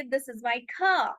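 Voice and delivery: slow British "car", r dropped to "kah"; British accent. Shown as a demonstration. Teacher shows how the British say it, British accent. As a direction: model British English, long open vowel in "car". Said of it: "British इसे कहेंगे".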